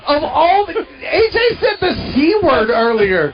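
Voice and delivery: laughing. Real, not acted. Shocked, laughing protest.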